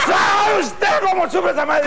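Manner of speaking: mock wailing shout. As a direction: cry it out like a suffering mother. Lamento exagerado, repetido más fuerte. (grito de lamento exagerado)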